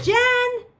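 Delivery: loud, high call. Acted. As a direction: shout her name up the stairs. Searching house calling for Jen.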